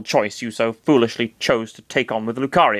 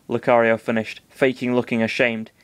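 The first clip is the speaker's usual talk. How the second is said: ordinary delivery, nothing marked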